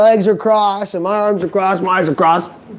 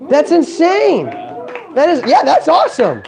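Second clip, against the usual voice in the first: amazed; 6 semitones higher than usual. Real, not acted. Amazed at his opportunity.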